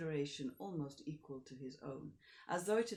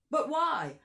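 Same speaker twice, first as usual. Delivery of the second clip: much higher and louder outburst; exasperated; 11 semitones higher, 13 dB louder than usual. Acted. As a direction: throw up the question in exasperation. Count exasperated.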